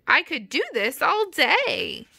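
high, sing-song excitement; excited character gushing; gleeful cry. Acted. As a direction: lilt it way up with delight. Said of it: "Sally cried".

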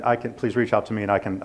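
ordinary talk, nothing marked

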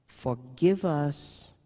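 very slow, deliberate words; nun quoting the father's prayer, slow; solemn prayer. Retold or imitated. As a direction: linger on each word.

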